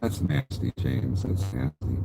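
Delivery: hushed disgusted whisper. Real, not acted. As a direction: breathe it out in disgust. Disgusted reaction.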